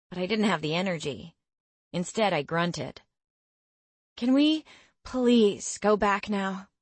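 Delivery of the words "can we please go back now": slow, whiny plea; weary pleading. Acted. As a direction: drag it out, begging to stop. Begging after running.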